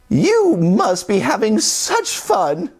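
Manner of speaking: put-on high, slow old-lady voice; sisters. Sisters admiring Sebastian, in a high voice. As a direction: marvel at it, high. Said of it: "they said".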